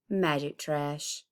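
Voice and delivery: plain reading voice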